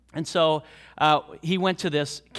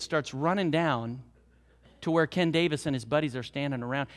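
ordinary talk, nothing marked

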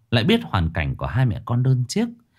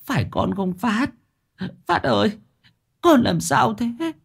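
put-on whimpering old mother; 14 semitones higher than usual. A narrator's reading. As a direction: whimper to the fallen son, voice crumpling. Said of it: "bà mếu máo".

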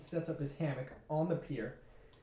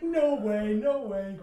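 mock disbelief; 5 semitones higher, 7 dB louder, much slower than usual. Roommate's disbelief acted out.

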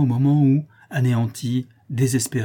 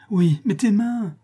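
slow, much higher voice; anxious concern; 10 semitones higher, 4 dB louder, slower than usual. Acted. Woman worries over her lover's cut hands, anxious concern. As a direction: brush off the question, fretting tenderly.

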